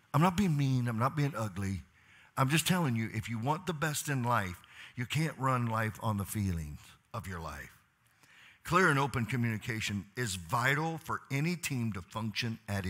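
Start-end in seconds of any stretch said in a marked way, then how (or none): none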